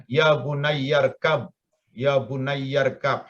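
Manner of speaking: "bunayyarkab" run together slowly as one word; joined, hamzah dropped. Shown as a demonstration. Teacher shows "bunayyarkab" joined, hamzah dropped. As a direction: slide from "bunayya" into "rkab" with no opening i. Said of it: "maka hamzah itu tidak dibaca, dihilangkan".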